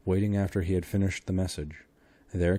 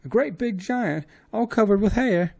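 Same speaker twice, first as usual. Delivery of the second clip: very high, loud alarmed cry; suddenly frightened woman; high wailing maid voice; 8 dB louder than usual. Acted. Maid describing the monster.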